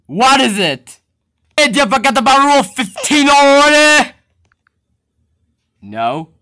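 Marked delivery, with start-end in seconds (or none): 1.5-4.1 stern scolding. Acted. Boss scolds about the rules.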